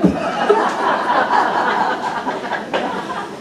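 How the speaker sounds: 숨소리 섞인 웃음; 소리 없이 터지는 웃음; breathy laugh. Real, not acted. Laughs at his own story. (설교는 몰라도 좋다는 말에 터진 웃음)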